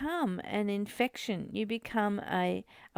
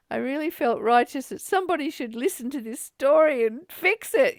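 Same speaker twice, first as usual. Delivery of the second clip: righteous indignation; 4 semitones higher, 6 dB louder than usual. Real, not acted. Indignant retelling of grievance.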